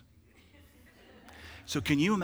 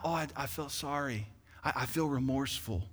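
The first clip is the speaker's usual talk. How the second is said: mock remorse; 7 dB quieter than usual. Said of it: "Repent is not".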